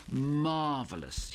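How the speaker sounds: slow drawn-out marvelous; drawled. Acted. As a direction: stretch the word out lazily, savouring it. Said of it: "he drawled".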